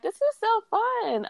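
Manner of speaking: high, slow "so fun"; giddy, drawn-out delight way up high; delighted quote. Retold or imitated. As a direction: savor it like a kid having a blast. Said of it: "I'm like".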